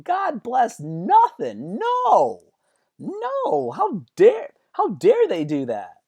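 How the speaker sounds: high, loud, stretched-out protest; hammed-up squealing indignation; indignant outburst. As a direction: explode in mock outrage, pitch shooting up. Acted indignant outburst, refusing forgiveness.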